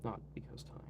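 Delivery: soft whispered correction. Real, not acted. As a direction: whisper it as a sly aside. Whispered self-correction of the joke.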